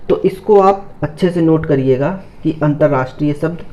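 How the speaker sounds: ordinary delivery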